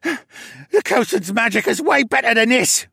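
high, loud, strained grunt; straining, defiant villain's minion; grunting captive. A narrator's reading. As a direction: force words out while struggling in bonds. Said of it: "he grunted".

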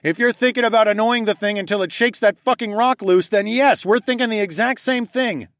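high, urgent rush of words; rattled off. A narrator's reading. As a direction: rattle it off in one excited breath. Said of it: "I rattled off".

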